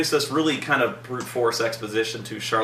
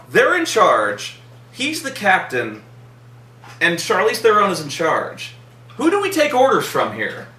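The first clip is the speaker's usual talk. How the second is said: confused exasperation; 6 semitones higher, 3 dB louder than usual. Retold or imitated. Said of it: "and I'm like".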